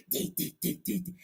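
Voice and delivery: low, soft repeated hoofbeat syllables; hushed, low galloping sound effect; imitated cattle hooves. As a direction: mimic hooves with quiet staccato di-di-di. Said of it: "hear the hooves of cattle".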